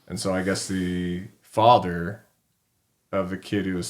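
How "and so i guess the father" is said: ordinary delivery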